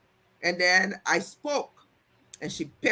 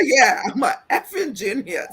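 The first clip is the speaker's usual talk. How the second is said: higher, louder retort with laughter around it; mock defiant comeback; 5 semitones higher, 5 dB louder than usual. Telling off a condescending coworker, mock defiance. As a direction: throw the comeback out loud, amused.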